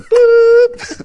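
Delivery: very high, loud horn boop; siren boop. Pretend. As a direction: blast it like a siren.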